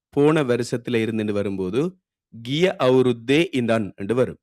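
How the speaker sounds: normal talking voice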